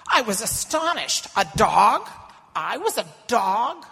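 stung and disbelieving; mother insulted by being called a dog; shocked outrage. Acted.

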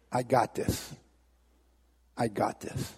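low, hushed, assured Jesus; soft, steady acted comfort; calm reassurance. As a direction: quietly take charge. Jesus reassures terrified man.

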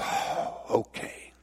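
sighed, voiceless, soft surrender; resigned giving in. Acted. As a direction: breathe it out in acted defeat. Acted brother gives in to blackmail.

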